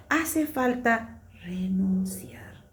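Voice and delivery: slow, breathy whisper. Real, not acted. Woman reading a devotional quote to the group in a slow whisper. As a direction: breathe the words out slowly.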